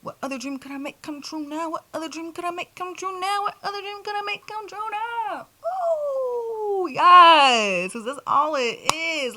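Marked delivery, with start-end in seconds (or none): 0.0-5.5 each repeat climbing higher; building excitement. Retold or imitated. To her viewers, the dream question rising higher every time. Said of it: "'cause I'm like". 5.7-7.9 high ooh, then a loud yes; excited ooh yes. Real, not acted. To her viewers, a high ooh then a loud yes.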